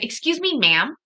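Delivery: high "excuse me", then a punched "ma'am"; rude customer-service ma'am. Shown as a demonstration. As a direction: demonstrate derogatory politeness with a loud ma'am. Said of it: "that's rude".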